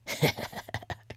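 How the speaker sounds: quick breathy snicker under the breath; stifle a snicker. Acted. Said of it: "Crystal snickered".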